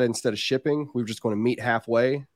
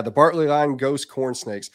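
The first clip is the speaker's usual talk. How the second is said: ordinary delivery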